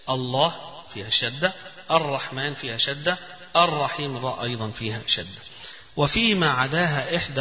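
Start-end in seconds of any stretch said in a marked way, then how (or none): none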